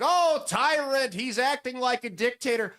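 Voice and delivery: mock the protesters crying tyranny; mocking protester whine. Protesters chanting at the governor, high mocking whine. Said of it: "the Democrats are chanting".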